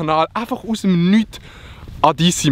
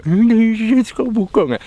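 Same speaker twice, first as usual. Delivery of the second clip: grumpy farmer; 6 semitones higher, 3 dB louder than usual. Retold or imitated. Farmers voiced, mock complaint.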